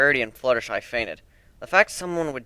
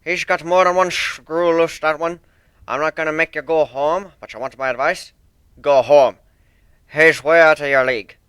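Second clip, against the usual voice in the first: put-on loud, stern old sailor with rolled R's; stern sea dog accent; 3 semitones higher, 7 dB louder than usual. One-winged Pegasus apologising to Fluttershy, stern thick accent. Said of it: "the Pegasus advised".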